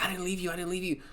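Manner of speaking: pleading. Retold or imitated. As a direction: beg him to believe you. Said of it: "she's like".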